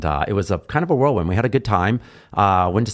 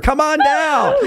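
much higher, louder, laughing announcer; game show host; 4 dB louder, much slower than usual. Shown as a demonstration. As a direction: call her down like a game show. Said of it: "I sound like a game show host".

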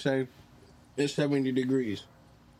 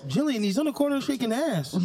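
gossiping coworker; 10 semitones higher than usual. Retold or imitated. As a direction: squeal it to a coworker. Friend voices gossiping staff.

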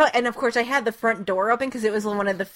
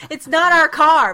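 loud, higher, laughing holler; mock shout back; 6 semitones higher, 8 dB louder than usual. Resident answering the street sweepers' yelling, laughing mock shout. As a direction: call back in mock exasperation.

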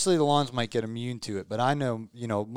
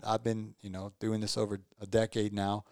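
ordinary talk

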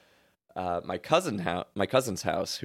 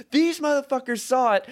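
loud, high retold protest; indignant raised voice calling on witnesses; arguing; 6 dB louder than usual. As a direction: argue your case, pitched way up. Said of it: "I am in an argument".

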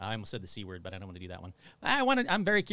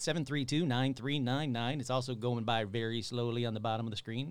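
ordinary talk

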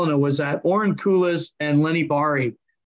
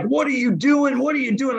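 mild mock anger, pitched higher; the angry owner, lightly imitated; retold yelling; 7 semitones higher than usual. Owner scolds the coach after the fake fight, mild mock yell. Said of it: "He's yelling at me".

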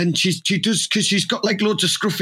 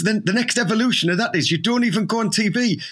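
ordinary talk

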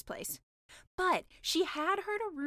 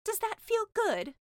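much higher, slow aghast question; horrified mermaid; 10 semitones higher, 5 dB louder, slower than usual. Acted. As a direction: ask in shock. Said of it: "asked the mermaid".

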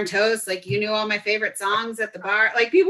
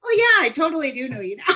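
fast, high, breaking into laughter; laughing while talking; 6 semitones higher, faster than usual. Real, not acted. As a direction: giggle through the words. Laughing through the realization.

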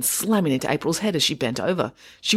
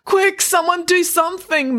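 high, drawn-out wail for help; moaning; 14 semitones higher, 4 dB louder than usual. A narrator's reading. Robbed shopkeeper to bystanders, moaning. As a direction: moan helplessly, stretching the words. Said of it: "moaned Mister Chelsea".

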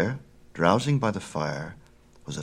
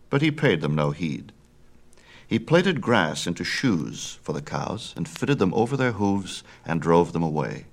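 usual speaking voice

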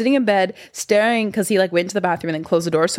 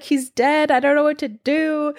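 higher-pitched mock panic; retold freak-out; 8 semitones higher than usual. Retelling finding the fiancé passed out, mock panicked. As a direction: replay your own panic, pitched up. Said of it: "I'm freaking out 'cause I'm so high".

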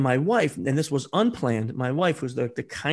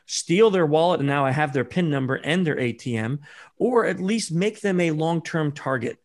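usual speaking voice

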